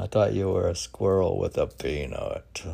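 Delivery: normal talking voice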